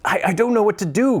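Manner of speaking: high, breathless joyful stammer; laughing and crying. Acted. Scrooge fumbling with stockings, laughing and crying together. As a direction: laugh and cry at once. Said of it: "laughing and crying in the same breath".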